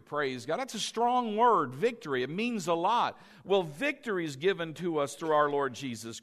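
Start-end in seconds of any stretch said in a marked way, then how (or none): none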